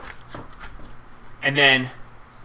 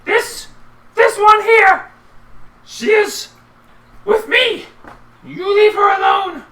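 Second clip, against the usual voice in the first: high, loud, commanding goblin-lord voice; warning the goblins off the player's character, loud and high; Little G; 10 dB louder than usual. Acted. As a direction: lay down the law from on high. GM voices Little G protecting her.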